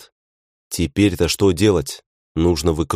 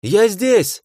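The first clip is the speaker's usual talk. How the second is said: high, ringing call. A narrator's reading. Hero stepping out, calling to the orcs. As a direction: call out to get noticed. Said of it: "выкрикнул я".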